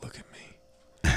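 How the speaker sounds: quiet menace. Retold or imitated. Conductor threatening fare dodger, quiet menace. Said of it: "I was like".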